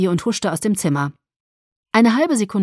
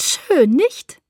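much higher, loud gushing; proud delight; 8 semitones higher, 6 dB louder than usual. A narrator's reading. Alice zeigt Bella stolz den Porsche, hoch. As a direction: show off the new car.

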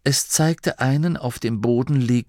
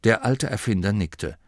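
ordinary delivery

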